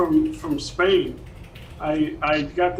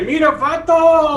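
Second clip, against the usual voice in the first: shouted Mexican slang, higher and slow; loud hammed-up Mexican greeting; Mexican accent; 9 semitones higher, 6 dB louder, slower than usual. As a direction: call out to your vato in mock Mexican. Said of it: "What do the Mexicans say?".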